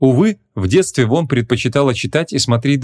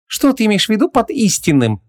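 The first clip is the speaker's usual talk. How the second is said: scoffing; 11 semitones higher than usual. A narrator's reading. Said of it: "хмыкнула Ванесса".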